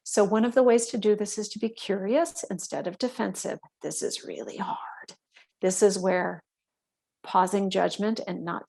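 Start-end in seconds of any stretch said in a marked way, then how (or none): 3.8-5.1 a quick whisper to the listener; whispered aside. Real, not acted. Teacher whispers to the class that this is really hard. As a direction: drop to a whisper, admitting it's tough.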